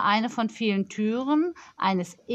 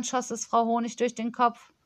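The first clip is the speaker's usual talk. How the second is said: plain reading voice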